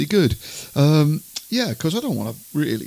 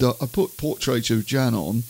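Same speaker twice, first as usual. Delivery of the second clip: ordinary talk, nothing marked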